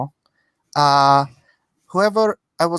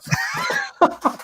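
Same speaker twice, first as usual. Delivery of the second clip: shrieking laugh. Real, not acted. Shout-laugh.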